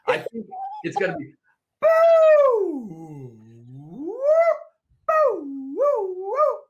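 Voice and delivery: very high boo and woo hoots; high warm-up hoots. Shown as a demonstration. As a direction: hoot way up high like a siren. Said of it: "trying to let someone know you were having a stroke".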